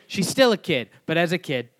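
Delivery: loud, playful ribbing; teasing. Real, not acted. Teasing the friend about his daughter.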